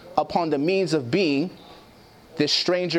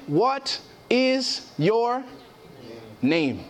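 drawn-out angel question; 2 semitones higher, much slower than usual. Acted. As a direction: draw the angel's question out slowly. Angel asks Jacob his name.

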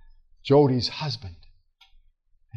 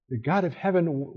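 ordinary delivery

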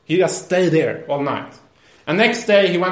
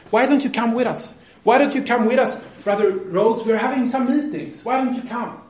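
higher-pitched retold coaxing; insistent urging; 7 semitones higher than usual. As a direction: keep urging him to come along. They don't give up.

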